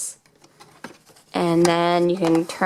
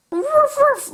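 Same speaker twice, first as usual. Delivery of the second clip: high, squeaky little puppy woof; puppy barking. Pretend. Toy pup barking at the kids, a squeaky high woof.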